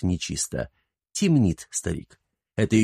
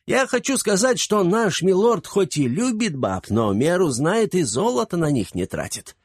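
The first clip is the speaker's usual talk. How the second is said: put-on high, chatty gossiping old man; gossipy old servant; 9 semitones higher than usual. A narrator's reading.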